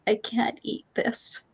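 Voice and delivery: whispered, slow and tearful; a small child too upset to eat; hushed, tearful kid voice; little girl whimper. Retold or imitated. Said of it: "and she says".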